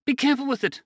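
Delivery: high, urgent call of warning; cried out. A narrator's reading. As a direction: Len cries out to Dean handling the box, anxious call. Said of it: "Len cried out".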